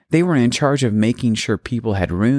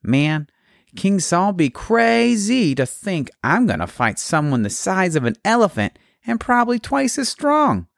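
higher, anxious soldier voice; nervous soldier; 11 semitones higher than usual. Acted. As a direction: gripe like a scared foot soldier. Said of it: "One Israelite turned to the other".

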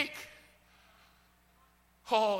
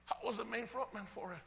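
softer, sobered confession; hushed repeat; 9 dB quieter than usual. Acted. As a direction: drop the volume and let it sink in. Same confession repeated softly afterwards.

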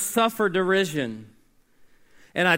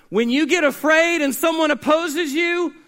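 higher and louder, mock warning; loud ironic preaching; 8 semitones higher, 5 dB louder than usual. Preacher quoting the culture's fearful advice, loud. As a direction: throw the world's advice back at them.

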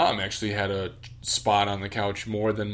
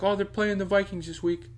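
lightly voiced dad, a bit higher; patient dad explaining; a hint of the dad answering; 8 semitones higher than usual. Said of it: "He'd be like".

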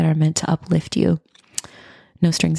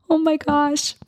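touched surprise; 10 semitones higher than usual. Real, not acted. Moved by listener's review.

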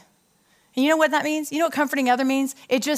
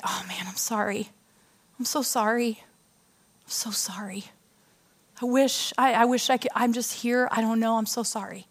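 tender whisper; 4 dB quieter than usual. Shown as a demonstration. Comforting a broken friend.

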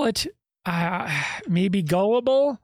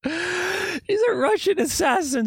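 high, drawn-out incredulous scoff; amused disbelief; 11 semitones higher than usual. Real, not acted. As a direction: stretch it out high, as if it's absurd. Scoffing at a claimed alter.

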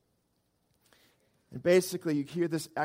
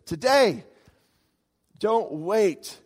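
emphatic call; 4 semitones higher, 6 dB louder, much slower than usual. Real, not acted.

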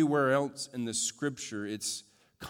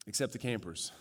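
deadpan punchline; 5 semitones lower, 7 dB quieter, faster than usual. Retold or imitated. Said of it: "a note that one camper wrote to her mom".

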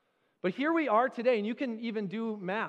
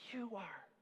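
whisper; 13 dB quieter than usual. Acted. Soft close after children's cry.

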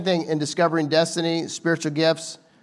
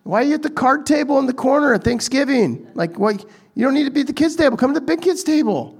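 higher, fast eager host inviting you up; host calling you up; 8 semitones higher than usual. Retold or imitated. Host calls a guest to the head table, eager and higher. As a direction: call them up to the table like a friendly host. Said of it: "better to be called up".

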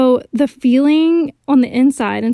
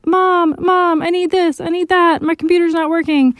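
put-on whiny kid voice; 5 semitones higher than usual. Kids calling for mom.

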